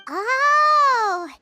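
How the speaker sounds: surprised realisation. Acted. Surprised realisation about the florist.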